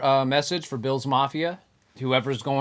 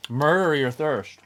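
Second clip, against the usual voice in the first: ad slogan voice; 4 semitones higher, 4 dB louder, slower than usual. Pretend. Slogan delivered as ad tagline.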